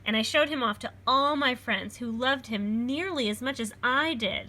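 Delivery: put-on lively read-aloud for children; storybook reading. A narrator's reading. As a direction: read it like a picture book to a class. Reads picture book aloud.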